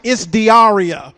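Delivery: slow, loud, indignant correction; mother correcting the pronunciation, slow and loud with attitude. Retold or imitated. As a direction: draw it out with attitude. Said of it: "She got an attitude with me".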